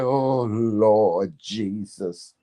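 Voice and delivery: very slow, drawn-out plea to the Lord; milk each word like a tearful church lady; overcome churchy sigh. Retold or imitated. Describing crying at moments, drawn-out mock plea to Jesus. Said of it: "I'll be like".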